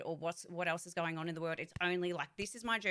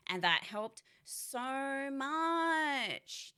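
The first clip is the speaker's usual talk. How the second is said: drawn-out relief; 7 semitones higher, much slower than usual. Real, not acted. Relief after describing forced rest.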